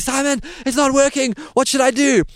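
begging for help, pitched right up; panicked plea. Retold or imitated. Stung narrator begging Simon again, high frantic plea. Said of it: "I say".

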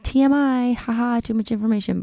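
mock TMI. Mocks her own oversharing.

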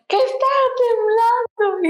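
high-pitched mock yell; hammed-up strained cry; retold yelling. Dad still shouting about the quake, mock yell. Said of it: "o sea, gritándole".

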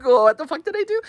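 high-pitched, incredulous squeal of a question; baffled protest. Retold or imitated. Woman reacting to Karen's angry messages, high and incredulous.

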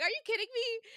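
high, loud disbelieving yell; loud outburst. Retold or imitated. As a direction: shout your disbelief at the page. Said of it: "Like, out loud".

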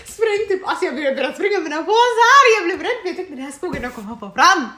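högt, gällt och skrattblandat utrop; berätta om flykten med hög, uppskruvad röst; uppjagat återberättande. Retold or imitated. Återberättar skrämd flykt i skogen. (uppspelt, högljutt och skrattigt)

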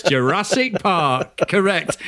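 slow, drawn-out Alan Partridge Jurassic Park. Retold or imitated. Quoting Alan Partridge to his co-host, slow Partridge voice. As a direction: stretch it out like a pompous Alan Partridge. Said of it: "As Alan Partridge says".

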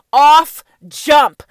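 punched out loud; loud emphasis. Acted. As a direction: hit it hard.

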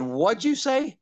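high-pitched incredulous question; retold disbelief. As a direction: replay a startled double-take at normal volume. Retold disbelief at strange request.

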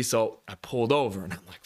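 ordinary talk

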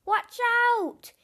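loud, high warning shout; yelled. A narrator's reading. Dad yelling a warning to his falling family. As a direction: bellow the warning. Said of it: "yelled Hamish's dad".

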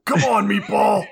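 high, loud wrestler growl; hammed-up Hulk Hogan taunt; Thunder Lips impression. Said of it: "Now you got your good impression in there".

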